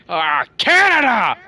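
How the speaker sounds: loud, high, drawn-out cry; quoted movie hero. Acted. Retelling the bridge fight after his hand breaks, mock agonized cry. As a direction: wail it slowly in mock agony. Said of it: "And he goes".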